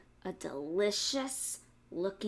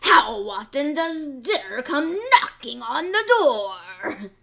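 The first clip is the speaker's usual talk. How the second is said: loud, slow gloating; gleeful fox; 4 semitones higher, 12 dB louder, slower than usual. A narrator's reading. Fox gloating to the piglet. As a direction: relish the joke. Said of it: "the fox shouted".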